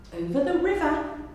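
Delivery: plain reading voice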